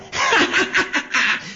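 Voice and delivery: high, taunting cackle; mocking witch cackle. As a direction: cackle at the boy. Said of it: "Se burló la bruja".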